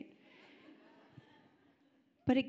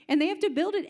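ordinary talk, nothing marked

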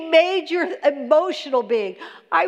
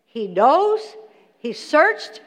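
usual speaking voice

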